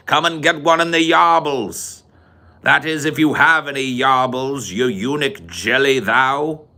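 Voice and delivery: high, ringing British taunt; Clockwork Orange accent. Acted. Daring Billy Boy to fight, high theatrical British. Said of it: "how, how's he say?".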